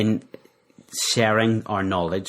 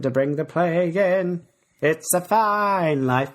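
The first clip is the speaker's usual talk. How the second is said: higher, slow sung-out stage line; theatrical line delivery; 9 semitones higher than usual. Retold or imitated. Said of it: "that was a line from the show".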